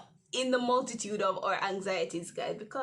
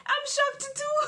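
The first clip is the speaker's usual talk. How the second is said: high shocked reply; 5 dB louder than usual. Retold or imitated. Replying to teacher.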